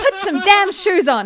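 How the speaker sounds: high, loud retold telling-off; mock scolding. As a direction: bark the order like a fed-up stranger. Translates the stranger's scolding.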